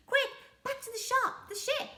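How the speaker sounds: high, hurried pirate urging; urgent pirate. Acted. Pirate captain hurrying his crew to the ship, high and urgent. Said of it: "said Handkerchief".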